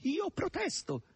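high, plaintive protest; fussy hog complaining in a high voice; howling hog. A narrator's reading. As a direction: wail your objection, stretching the last cry. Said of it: "ululò questi".

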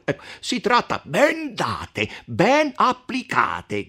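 emphatic, drawn-out exclamation; crying out. A narrator's reading. Count Attilio approving the beating, crying out. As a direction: interject with relish, stretching the words. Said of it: "gridò il conte Attilio".

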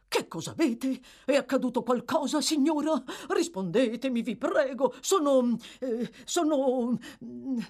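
high, urgent questioning, faltering at the end; alarmed. A narrator's reading. Bumble bending over the gasping matron, alarmed. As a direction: fuss in alarm, then stumble for words. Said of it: "allarmato com'era".

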